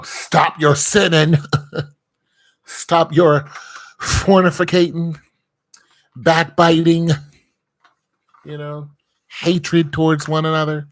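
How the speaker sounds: loud, rising preacher's list of sins; preaching. Acted. Preaching loudly at the listeners against sin. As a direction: call out each sin from the pulpit.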